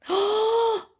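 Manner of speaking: high-pitched excited gasp; startled gasp. Real, not acted. Vlogger hears something arrive, high startled gasp.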